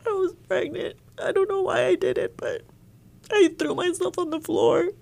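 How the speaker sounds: breaking up, high and hushed while retelling; reliving the news, voice strained and unsteady; real distress. Real, not acted. Telling the interviewer how she fell apart, voice strained and high. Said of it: "I lost it".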